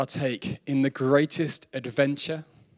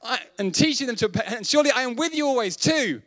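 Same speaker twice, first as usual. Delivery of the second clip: raised, ringing proclamation of the verse, higher; scripture reading; 9 semitones higher than usual. Acted. Reads Great Commission aloud.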